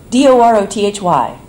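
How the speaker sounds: loud, letter by letter like a spelling bee; blurted spelling. Retold or imitated. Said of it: "she blurted out, as if for a spelling bee".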